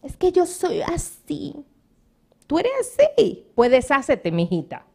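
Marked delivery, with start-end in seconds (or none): 0.0-1.5 slow, high, lightly voiced excuse; a hint of a coy woman shrugging it off; a touch of a flirt's excuse; mock flirt. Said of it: "comienza a repetir". 2.5-3.5 mocking echo. Real, not acted. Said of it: "mocking echo".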